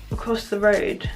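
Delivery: ordinary talk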